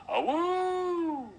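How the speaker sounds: very high, loud wolf howl. A narrator's reading. Approaching wolves howl near the bush, loud high howl. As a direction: let a long, high howl ring out. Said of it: "hear the wolves even louder".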